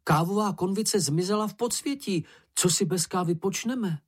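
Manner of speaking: high-pitched voice of a story character in dismay; dismayed exclamation. A narrator's reading. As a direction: lament it in a high voice. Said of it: "vykřikl".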